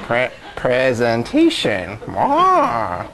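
high, stammering alien warble with laughs; squeaky alien sounding out one word; Yip Yip Alien voice. Shown as a demonstration. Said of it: "in the Yip Yip Alien voice".

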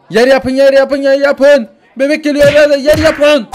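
high, loud urgent commands; frantic shouting; shouting orders. Acted. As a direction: yell at the crowd to move. Clearing crowd for birth.

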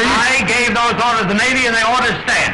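king defying the dictator over his naval orders; indignant defiance. Acted. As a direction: stand your ground, voice rising.

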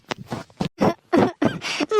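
halting wordless sobs; sobbing. Acted. Maid promised a fine wedding, sobbing. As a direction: cry before finding words. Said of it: "ನೀನ್ ಅಳ್ಬೇಡ".

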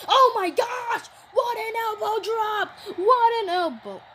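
loud, slow, high hype shout; hyped shout. Real, not acted. Gamer shouting at an elbow drop in loud hype. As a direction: announce it like a wild commentator.

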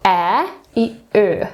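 вимовлені по-фінськи, повільно; Finnish Ä and Ö. Shown as a demonstration. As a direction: Finnish letters said slowly and carefully. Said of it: "І як ми вимовляємо цю букву?". (фінські Ä і Ö, протяжно й чітко)

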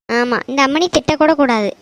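ordinary talk

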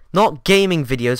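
normal talking voice

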